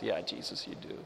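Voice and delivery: calm Elijah. Retold or imitated. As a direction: answer flatly, low and quiet, like a settled elder. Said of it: "And Elijah says".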